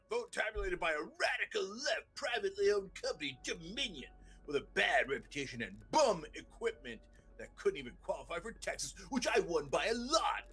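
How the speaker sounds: high, whiny reading of the tweet; mock Trump reading his own tweet. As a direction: read it as he hears it in his head. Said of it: "I'm reading it in a certain tone of voice".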